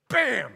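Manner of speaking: a loud, high "Bam!" like a shotgun blast; mock gunshot. Dad showing how family shoots ideas down, loud mock gunshot. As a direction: blast it out like a shot.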